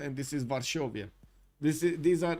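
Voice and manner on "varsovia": "Varsovia" as var-SHO-vi-a; Romanian pronunciation. Shown as a demonstration. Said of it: "the Romanian pronunciation for these ones".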